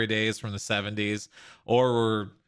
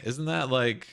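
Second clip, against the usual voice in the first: normal talking voice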